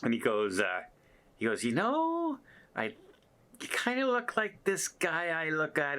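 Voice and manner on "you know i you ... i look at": much higher, tentative voice; hint at it shyly, voice up high; shy crew man. Retold or imitated. Photo worker hinting he knows the vlogger, voiced higher.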